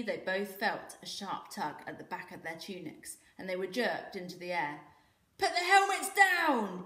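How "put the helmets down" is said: very loud, slow, pitched-up command; booming blacksmith. A narrator's reading. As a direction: boom the order at the boys. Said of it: "boomed the blacksmith".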